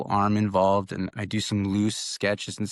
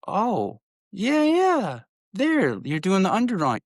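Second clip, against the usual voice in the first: much higher and louder, a gushing cheer; excited praise; 11 semitones higher, 4 dB louder than usual. Retold or imitated. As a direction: cheer her on like she just nailed it. Mom excitedly praising daughter's drawing.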